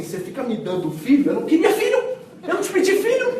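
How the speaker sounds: high, half-laughing mock rant; scolding. A mother scolding the prophet in a hammed-up rant. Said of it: "ela vai e descasca o profeta".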